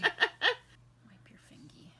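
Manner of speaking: slow, whispered through laughter; laughing while giving instructions. Real, not acted. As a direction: giggle it out slowly.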